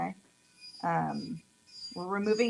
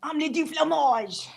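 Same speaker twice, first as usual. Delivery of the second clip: higher, hammed-up French accent; playful fake French accent introduction; mock French accent; 6 semitones higher, 4 dB louder than usual. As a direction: put on a jokey French accent for the name. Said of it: "France just won".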